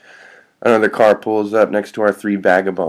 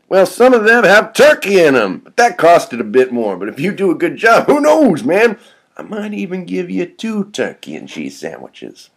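put-on high-pitched, excitable salesman kid; eager kid recruiter; 11 semitones higher, 6 dB louder than usual.

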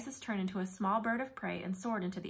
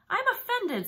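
high, louder wounded protest; mock hurt; 12 semitones higher, 7 dB louder than usual. A narrator's reading. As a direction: Set pretending to be hurt by the accusation. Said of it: "Set sounded genuinely hurt".

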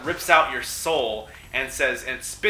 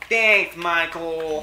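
mock gratitude; 5 semitones higher, 6 dB louder, much slower than usual. Mock-proud thanks after winning.

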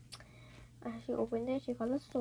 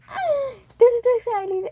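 high, loud excited gasp; real thrill, voice jumping up loud; excited squeal; 9 semitones higher, 10 dB louder than usual. Real, not acted. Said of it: "but I'm excited".

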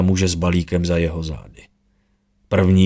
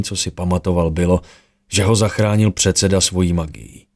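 plain reading voice

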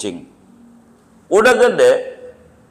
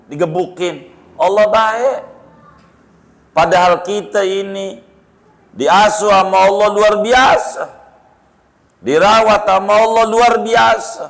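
fiery preaching; 8 semitones higher than usual. Acted. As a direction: preach to the courtroom with full force. Hakim berceramah penuh semangat. (hakim berkhotbah lantang kepada hadirin di sidang)

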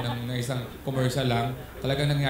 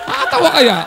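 laughing; 7 dB louder than usual. Real, not acted. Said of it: "comedian laughs at own joke".

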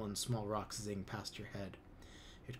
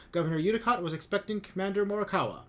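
higher, louder, fast insistence; firm challenge; 9 semitones higher, 7 dB louder, faster than usual. A narrator's reading. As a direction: press the point quickly. Seek challenges Lum.

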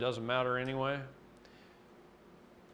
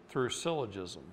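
ordinary talk

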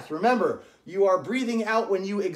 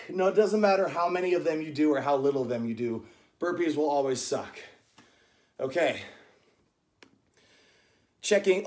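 ordinary talk, nothing marked

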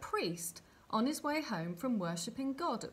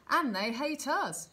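loud, high, slow retort; raised-voice indignant objection, drawn out; shouted protest; 6 semitones higher, 6 dB louder than usual. A narrator's reading. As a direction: shout it emphatically from the crowd. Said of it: "shouted a third".

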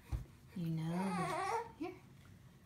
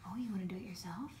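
whispering; 8 dB quieter than usual. Real, not acted. Quiet aside during feeding.

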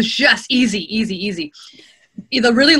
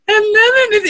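high, loud imitation crying voice; mock tearful; 12 semitones higher, 6 dB louder than usual. Narrator imitating breaking down mid-chapter, tearful. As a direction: choke up theatrically, voice leaping high. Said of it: "your voice gets all messed up 'cause you're crying".

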